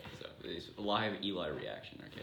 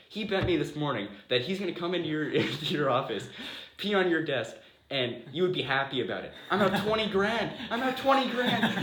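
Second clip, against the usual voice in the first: hammed-up outrage, ending half laughing; mock furious rant; 8 semitones higher, 8 dB louder than usual. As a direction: rant like a lawyer who just lost twenty grand. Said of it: "He's really mad".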